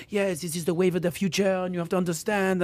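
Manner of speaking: a light, soft-spoken imitation of a French accent; a hint of a French art seller, said quietly; lightly imitating a French accent. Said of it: "he has this, like, French accent".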